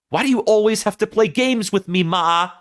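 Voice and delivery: high-pitched, hammed-up attempt at Harry's accent; badly faked movie accent. Son complaining to his mother through the door, badly faked accent. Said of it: "I can't do this accent".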